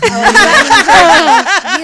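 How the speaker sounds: put-on squealing Valley girl voice; loud Valley girl squeal. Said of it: "You haven't even heard me do my Valley girls".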